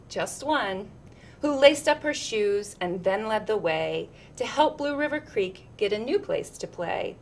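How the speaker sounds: ordinary delivery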